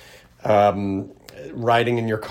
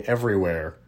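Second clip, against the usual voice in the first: usual speaking voice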